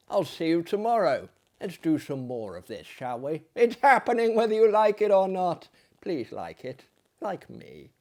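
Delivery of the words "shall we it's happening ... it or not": ordinary delivery, nothing marked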